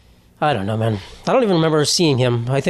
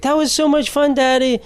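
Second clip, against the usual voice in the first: put-on high, excited small-boy voice; imitated happy child, pitched way up; cute little kid; 9 semitones higher than usual. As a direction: gush like a sweet child to Daddy. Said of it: "he was so cute at the end".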